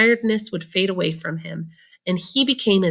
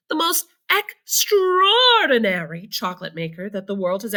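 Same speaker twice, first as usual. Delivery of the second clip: excited grandpa; 5 semitones higher than usual. A narrator's reading. Grandpa Joe praising Mr Wonka to Charlie, rising excitement. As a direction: marvel at every word. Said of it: "said Grandpa Joe".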